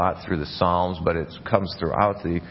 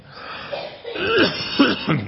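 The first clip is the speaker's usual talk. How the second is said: a hard real cough. Real, not acted. Coughing fit.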